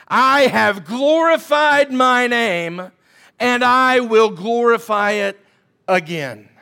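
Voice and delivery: slow, loud, booming sass as God; God showing off, slow and loud; sassy God. Acted. Preacher voicing God with loud, slow sass. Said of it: "God's having a sass moment".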